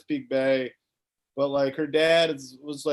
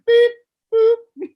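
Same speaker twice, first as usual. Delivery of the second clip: beeping game noise; 10 dB louder than usual. Retold or imitated. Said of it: "I was just like".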